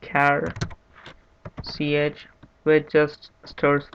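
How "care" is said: "char" with a hard k, like "care"; "char" pronounced "kair"; preferred pronunciation. Shown as a demonstration. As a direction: say "char" as the start of "character". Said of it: "I prefer calling it".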